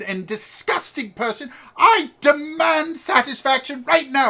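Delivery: very high, loud, outraged little aristocrat; posh kid. Acted. Posh boy demanding satisfaction from Reggie, shrill loud outrage. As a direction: demand a duel like a shrunken lord. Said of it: "how posh this kid is".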